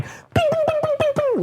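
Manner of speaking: ping out each deflected bullet; squeaky cartoon ricochets; bullet ricochet noises. Imitating blocking bullets.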